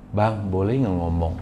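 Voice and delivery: gently asks husband's permission; timid wife asking. Retold or imitated. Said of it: "Sampai dia reda".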